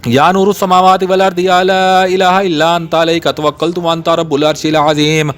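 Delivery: ordinary delivery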